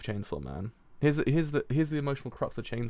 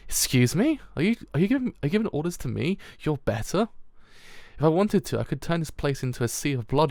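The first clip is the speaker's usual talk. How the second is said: indignant Power; 4 semitones higher than usual. Acted. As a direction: act scandalized at being ordered about. Power voiced, indignant at veggie order.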